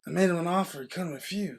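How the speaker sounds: soft, lightly voiced Godfather; mob boss impression. Matching co-host's Godfather attempt, soft light impression. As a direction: give the don's line quietly. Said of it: "Can you do it?".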